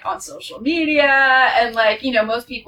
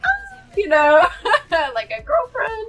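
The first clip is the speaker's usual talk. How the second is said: nervous laugh; 11 semitones higher than usual. Retold or imitated. Laughing awkward answer.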